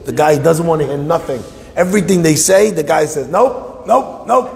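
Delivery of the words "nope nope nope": high-pitched repeated refusals; mock obstinate manager; stubborn refusal. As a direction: bat every plea away, acting stubborn. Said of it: "The guy is stubborn like a hamor".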